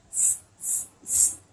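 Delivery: short voiceless hisses; monkey hissing. A narrator's reading. Monkeys mocking the peddler back, hissing. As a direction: hiss in separate bursts like monkeys. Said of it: "and they said".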